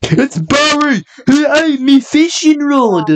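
very high, drawn-out mock complaint; exaggerated high put-on voice; silly character voice. Friend copying a joke about Barry in a high silly voice. Mimicking the other's silly line.

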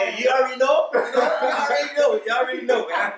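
high laughing brag. Real, not acted. Guest bragging about meeting wife at twelve, laughing high. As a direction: boast it through laughter, high.

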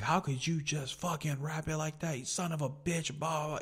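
mock furious chewing-out; cussing him out. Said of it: "cussing that doctor out".

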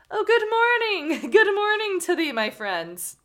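loud, slow, high call from the sack; hammed-up cry. Prisoner in the sack hailing the student, loud and hammy. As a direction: call out grandly to a passer-by. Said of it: "he cried out".

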